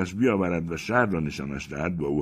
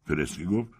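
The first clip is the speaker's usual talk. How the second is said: ordinary delivery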